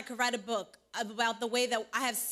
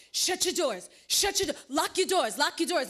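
urgent, higher, louder warning shouts; panicked shouting; 4 semitones higher, 4 dB louder than usual. Retold or imitated. Friend warning her to lock the car door, panicked shouting. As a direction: bark it out as if danger is at the window.

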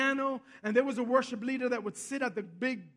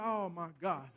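low, soft aside of dismay; retold inward groan of alarm, dropped right down; sinking dread; 7 semitones lower, 7 dB quieter than usual. Companion fearing a fight on the train, sinking dread.

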